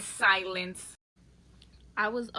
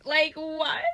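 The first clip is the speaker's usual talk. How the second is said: incredulous; 6 semitones higher, 4 dB louder than usual. Real, not acted. Reacting to the date's rap.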